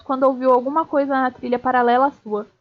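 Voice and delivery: ordinary delivery, nothing marked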